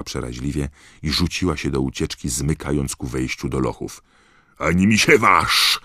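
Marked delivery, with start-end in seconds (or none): 4.6-5.8 loud, raised-pitch roar of warning; Moody's roar. A narrator's reading. Moody warns Crabbe off the ferret, roaring. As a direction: roar it to stop him dead. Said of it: "ryknął Moody".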